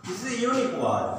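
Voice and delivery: slow model sentence. Shown as a demonstration. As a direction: model it slowly for students. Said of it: "আরেকটা example দেখো".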